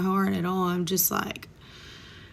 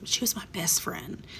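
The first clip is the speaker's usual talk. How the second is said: soft unvoiced whisper, softer; hurt whisper; 7 dB quieter than usual. Explaining betrayal to friend, soft whisper. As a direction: breathe it out like a quiet wound. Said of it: "It's like".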